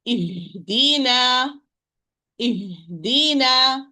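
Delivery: "Ihdina" drawn out slowly, the h a controlled partly open breath; slow careful teaching demo of "Ihdina" with audible h; correct ha. Shown as a demonstration. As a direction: hold a clear, measured h in "Ihdina". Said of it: "Partly opening between the vocal cords".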